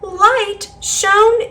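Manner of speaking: usual speaking voice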